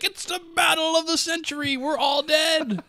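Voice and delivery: hammed-up high wail of doom, last words stretched; hysterical newscaster. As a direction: scream the end of the world like a mock anchorman. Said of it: "to this guy is like".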